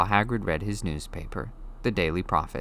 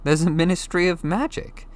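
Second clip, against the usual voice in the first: higher, eager astonished question; surprised Harry; 10 semitones higher than usual. A narrator's reading. Harry blurting a question at Hagrid, surprised. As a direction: blurt it before you can stop.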